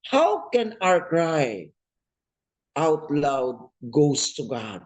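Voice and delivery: slow weighty preaching question; slow emphasis. Shown as a demonstration. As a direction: announce the sermon's question slowly. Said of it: "what is the secret".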